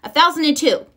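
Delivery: a touch of snapping rivalry; snapped. A narrator's reading. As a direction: snap it back competitively. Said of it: "snapped Sister".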